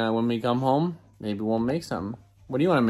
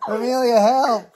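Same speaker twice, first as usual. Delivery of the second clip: pleading; 11 semitones higher, 7 dB louder, slower than usual. Real, not acted. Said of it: "I'm being attacked".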